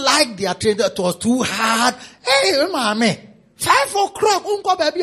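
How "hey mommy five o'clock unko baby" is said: put-on high pleading child's voice; high childish whine to mommy; whining child. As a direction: beg mommy like a spoiled kid.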